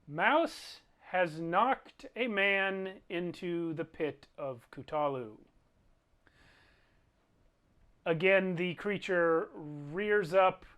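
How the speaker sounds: slow, drawn-out storyteller delivery; game master's dramatic narration. Acted. Game master narrates a man knocked into the pit, dramatic narration. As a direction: stretch the key words for drama.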